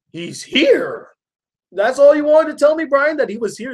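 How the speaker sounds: high-pitched retold disbelief; acted-out high, indignant questioning; incredulous. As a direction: pitch it up, imitating an incredulous reaction. Retold Marge reacts in disbelief.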